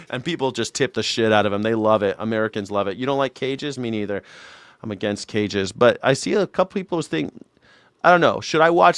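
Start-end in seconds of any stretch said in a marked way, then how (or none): none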